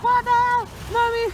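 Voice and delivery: high, loud cry for Mommy; loud, high-pitched exclamation; flustered outburst. Real, not acted. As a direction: yelp it out in comic panic. Flustered by passing cars, calls out.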